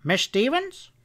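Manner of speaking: high, singing-out cheery greeting; cheery doctor hailing a helper; cheerful. A narrator's reading. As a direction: call her name brightly from another room. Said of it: "said Doc Trimble cheerfully from inside".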